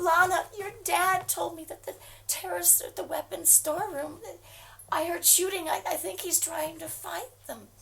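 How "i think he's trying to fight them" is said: ordinary talk, nothing marked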